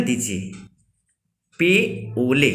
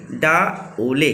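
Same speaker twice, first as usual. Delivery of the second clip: पे ओले said very slowly, word by word; slow, with pauses; much slower than usual. Shown as a demonstration. Teacher drilling the Kharia phrase with class, slow and paused. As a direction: space out पे and ओले for learners. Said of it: "रुक के बोलना है".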